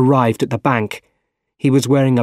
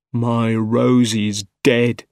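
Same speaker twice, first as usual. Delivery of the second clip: slow, drawn-out lament; grieving; much slower than usual. A narrator's reading. Widower alone by the river, grieving slowly. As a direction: draw it out in grief.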